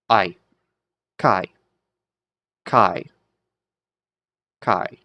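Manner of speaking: "Kai" with the vowel sounding like English "I"; diphthong pronunciation. Shown as a demonstration. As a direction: sound out "I", then "Kai", as separate demonstrations. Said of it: "How's that, uh, diphthong pronounced?".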